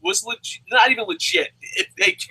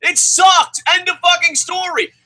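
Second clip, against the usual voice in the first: loud, high, emphatic verdict; angry shouting; 7 semitones higher, 4 dB louder, slower than usual. Real, not acted. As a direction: shut the argument down forcefully. Cutting off co-host in heated argument.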